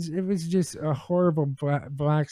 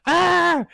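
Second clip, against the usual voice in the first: loud, high "ah!" scream; freak-out scream; 12 semitones higher, 6 dB louder than usual. Retold or imitated. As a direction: re-enact a panicked yelp mid-story. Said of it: "I freak the fuck out".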